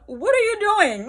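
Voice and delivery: high, loud disbelief; incredulous demand. Retold or imitated. She confronts her ex, loud and incredulous. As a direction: demand an answer in shock.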